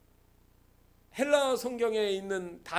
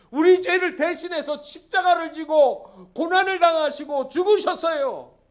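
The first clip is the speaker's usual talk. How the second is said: loud, raised, building sermon climax; impassioned preaching; 7 semitones higher, 11 dB louder than usual. Real, not acted. Preacher proclaiming God's self-emptying love, impassioned full-force delivery.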